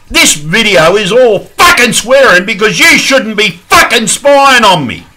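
outraged, high and forceful; angry yelling. Real, not acted. Vlogger telling YouTube to stop spying on him, angry yelling. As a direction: really let rip, leaning hard on each swear word. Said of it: "for yelling".

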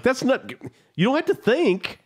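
high, disbelieving mock reply; exasperated high retort. Caller retelling his disbelieving reply, high and exasperated. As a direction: squeak it out in disbelief.

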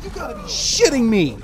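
loud, high cry falling at the end; real frustrated outburst; outraged disbelief. Real, not acted. Player losing to the cats, outraged disbelief. Attacked in game.

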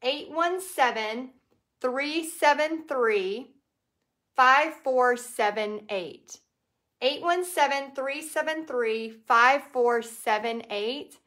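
slow, spaced-out digits for typing; careful, deliberate phone number read-out; slow number dictation. Real, not acted. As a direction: read each digit slowly so they can write it down. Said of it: "if somebody wants to type this".

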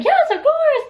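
high, thrilled acceptance; excited yes. Retold or imitated. Asked to be in his music video, excited yes. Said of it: "And I was like".

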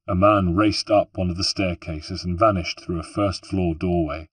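ordinary delivery, nothing marked

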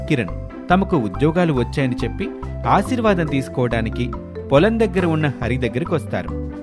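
usual speaking voice

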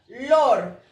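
"Lor" isolated, loud and drawn out; stressed syllable alone. Shown as a demonstration. Teacher showing the syllable with más fuerza, stressed syllable alone. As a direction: punch out "Lor" as the strong syllable. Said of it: "la que tiene más fuerza".

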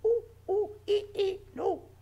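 high, loud monkey hoots; monkey noises. Pretend. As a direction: hoot like a silly monkey for the kids. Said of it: "and they'll go".

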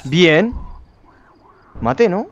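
usual speaking voice

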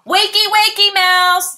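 loud high kitten yell. Acted. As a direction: shout him awake while bouncing. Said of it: "yells Max".

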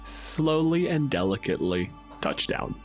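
usual speaking voice

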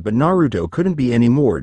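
ordinary delivery

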